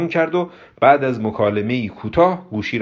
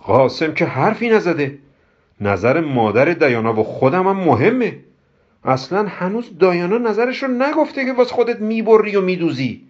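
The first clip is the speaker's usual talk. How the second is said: high, indignant scolding; angry; 9 semitones higher, 3 dB louder than usual. Acted. Kobra objects that nobody was asked, angry scolding. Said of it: "با عصبانیت گفت".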